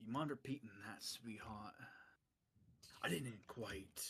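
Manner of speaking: soft, threatening bandit murmur; menacing hush. Acted. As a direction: lean in and threaten quietly. Bandit menacing party member.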